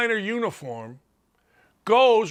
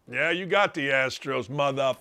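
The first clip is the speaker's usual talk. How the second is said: sarcastic jab; 6 semitones lower, 5 dB quieter than usual. Real, not acted.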